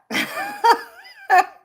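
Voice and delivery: high, loud burst of laughter; big laugh. Real, not acted. Streamer laughing at a viewer's request, a high loud burst. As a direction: cracks up at the chat's question.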